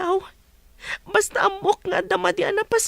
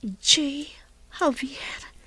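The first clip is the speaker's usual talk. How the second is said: soft whispered reading of a name; 4 semitones lower, 3 dB quieter, much slower than usual. Acted. Woman reading the name on the necklace, whispering.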